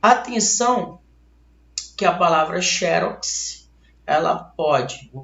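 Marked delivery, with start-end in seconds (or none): none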